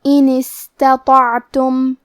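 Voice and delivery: slow recited "ان استطعتم" with a weak, airy س; soft hissed seen. Shown as a demonstration. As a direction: recite "ان استطعتم" slowly, letting breath run through the س. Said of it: "تو ہم یہ کلمہ کو ایسے پڑھیں گے".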